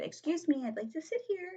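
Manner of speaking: put-on high, little-kid asking voice; dainty high-pitched request; polite child. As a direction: ask politely in a high, small voice.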